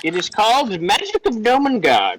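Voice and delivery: high, lilting gnome voice; gnome scientist. Acted. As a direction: present it in a high, measured gnome voice.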